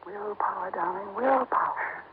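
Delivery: quiet, slow murmur; soft coaxing. Acted. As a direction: coax him under your breath. Wife urging husband not to engage.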